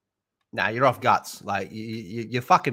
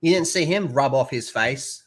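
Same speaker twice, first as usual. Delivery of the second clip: normal talking voice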